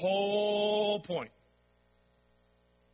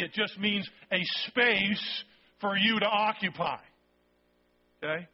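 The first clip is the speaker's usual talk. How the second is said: ordinary delivery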